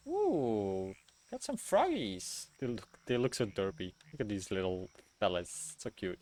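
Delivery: soft cooing over cute frogs. Real, not acted. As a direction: coo at them gently.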